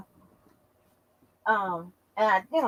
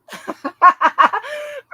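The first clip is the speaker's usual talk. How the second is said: big real laugh, high and loud; 12 semitones higher, 5 dB louder than usual. Real, not acted. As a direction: burst out laughing. Laughs at the insult.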